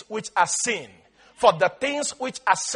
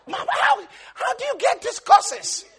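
much higher, with a laugh; amused asking; 10 semitones higher than usual. Retold or imitated. Boy asking grandmother about her curses, higher, laughing. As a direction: ask through laughter. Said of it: "I'd be like".

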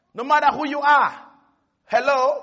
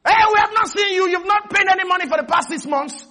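higher, louder scolding banker; stern bank officer; 6 semitones higher, 3 dB louder than usual. Acted. Preacher voicing the bank officer's loud demand for payment.

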